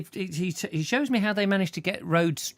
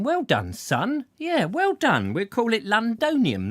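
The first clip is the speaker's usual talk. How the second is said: mock proud Roman elder; 7 semitones higher, 3 dB louder than usual. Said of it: "They said".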